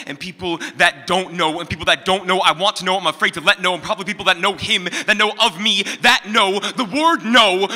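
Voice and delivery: spoken-word crescendo to near shouting; building impassioned outcry. Acted. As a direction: start firm and climb to a high, loud cry. Poem builds to repeated no.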